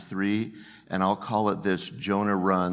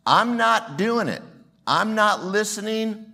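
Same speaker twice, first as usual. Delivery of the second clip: defiant mock refusal; 11 semitones higher, 4 dB louder than usual. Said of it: "they can do what Jonah did".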